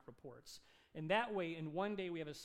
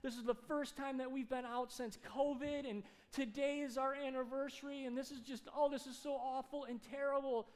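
high, distressed rush of upset; lightly acted wailing, pitched up; crying wife, imitated; 7 semitones higher, 4 dB louder than usual. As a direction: imitate a wife tearfully lamenting. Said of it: "his wife starts crying".

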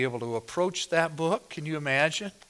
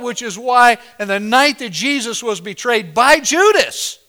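loud, ringing pulpit delivery; thundering sermon voice; full-force preaching; 6 semitones higher, 10 dB louder than usual. Acted. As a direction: proclaim it to the whole congregation.

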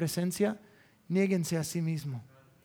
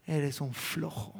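quiet rebuke; 4 semitones lower, 7 dB quieter, slower than usual. Acted. Holy Spirit scolding.

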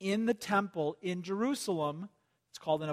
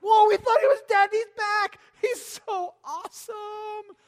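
high, loud gasp of wonder at a comeback; amazed admiring crowd; 7 dB louder than usual. Retold or imitated. Preacher voicing the world amazed the Antichrist lived. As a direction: play the starstruck world cheering him.

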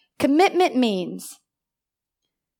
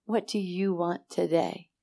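soft voice of the Lord; 5 semitones lower, 10 dB quieter than usual. Retold or imitated. Voicing the Lord again.

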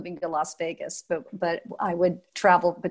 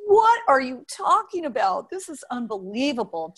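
amazed disbelief; 6 semitones higher than usual. Retold or imitated. Awed young dancer seeing blueprints.

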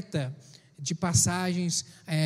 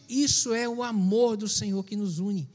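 ordinary talk

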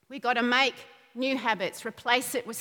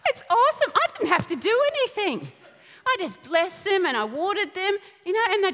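excited laughing; 6 semitones higher than usual. Real, not acted. Preacher telling the congregation about her potatoes, laughing with delight. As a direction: gush about your garden.

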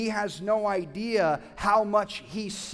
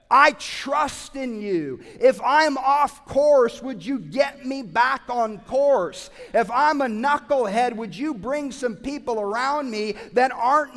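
fervent prayer; 4 semitones higher, 9 dB louder than usual. Acted. Pastor voicing a believer's prayer, loud and pleading. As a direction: cry out to the Lord for help. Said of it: "have hope and say".

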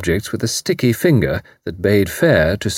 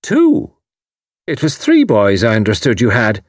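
sharp Irish landlady; 4 dB louder than usual. A narrator's reading. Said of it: "cut in quickly".

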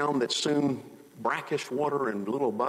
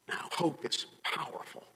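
ordinary talk

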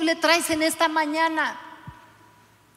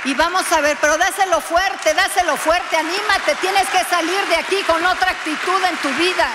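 pitched up, loud rallying cry; loud urging; 4 semitones higher, 5 dB louder than usual. Real, not acted. Pastor urging the congregation to shout it out, loud and rallying. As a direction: fire up the crowd.

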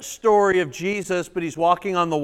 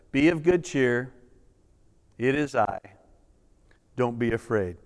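calm frightened men gently; tender hushed promise; reassuring softly; 7 semitones lower, 4 dB quieter than usual. Acted. Repeating Jesus' reassurance.

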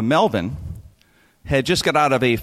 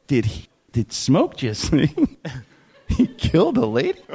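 incredulous. Retold or imitated. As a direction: half-whisper it in shock. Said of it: "I'm like".

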